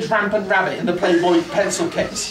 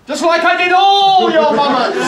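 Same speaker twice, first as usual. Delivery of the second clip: shouted punchline; 8 semitones higher, 7 dB louder than usual. Pretend.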